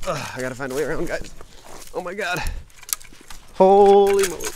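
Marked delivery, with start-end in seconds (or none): 1.9-4.5 loud, high, startled holy moly; real surprise at the steep climb; amazed exclaim. Real, not acted.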